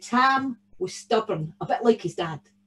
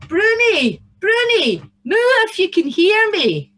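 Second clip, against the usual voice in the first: high, loud swooping call; shouting for Bruni; 9 semitones higher, 9 dB louder than usual. A narrator's reading. Tam searches for his stolen cow, shouting. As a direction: holler for a lost cow. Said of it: "he shouted".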